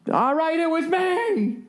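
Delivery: high, slow, hammed-up breakdown on the stand; old TV courtroom confession. Defendant cracking on the stand in Ironside, hammy high confession. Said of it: "like on one of those classic, uh, Ironside".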